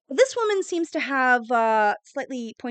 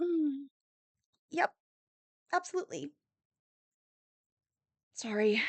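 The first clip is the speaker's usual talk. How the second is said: weary mother; 2 semitones higher, 9 dB quieter than usual. Acted. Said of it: "her mother just like".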